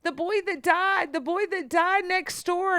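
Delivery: very high, insistent child imitation; mock screaming child. Small son telling mother who he sees, mock panicked wail. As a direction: re-enact a frightened boy's high-pitched cry. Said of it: "just starts screaming and pointing toward the closet".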